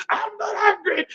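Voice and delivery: hammed-up high denial; mock angry denial. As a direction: squeak out the denial like a sulky angry person. Angry person denying anger.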